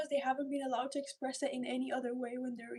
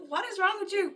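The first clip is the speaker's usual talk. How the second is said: higher, louder, "wrong" leaned on in disbelief; mock self-scolding; 6 semitones higher, 5 dB louder than usual. Looking at herself from outside, mock scolding, higher and louder. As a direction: scold yourself with a half-laugh.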